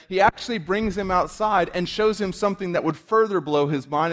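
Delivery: high, loud, fervent preaching; impassioned preacher voice; full-force preaching. Acted. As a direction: preach it out to the congregation, building.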